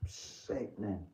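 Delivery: soft, slow, drawn-out disgusted murmur; near-silent slow disgust; hushed repeat. Real, not acted. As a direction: say it again under your breath, slowly.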